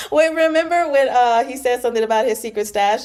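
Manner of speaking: loud laughing burst of recall; real laughing outburst. Real, not acted. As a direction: laugh as the memory hits.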